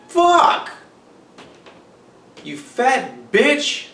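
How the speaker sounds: high pitched, far-off yelled curse; loud neighbor shouting. Retold or imitated. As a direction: yell like a rowdy neighbor. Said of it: "all my neighbors are as loud as I am".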